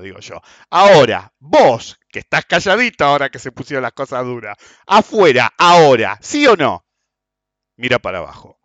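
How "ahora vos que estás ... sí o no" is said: loud, firm, daring; commanding challenge. Retold or imitated. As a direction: dare him to step outside. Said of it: "challenging the second man".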